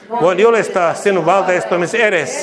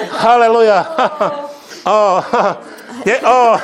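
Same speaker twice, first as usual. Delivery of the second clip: holy laughter; 4 semitones higher than usual. Real, not acted. Holy laughter during prayer.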